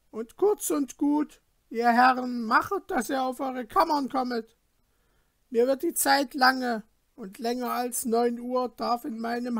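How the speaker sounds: a stern hostess sending guests to bed; innkeeper's wife. Acted. Innkeeper's wife voiced in story.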